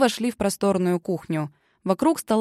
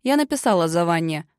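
ordinary delivery

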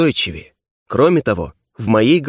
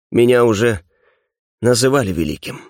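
plain reading voice

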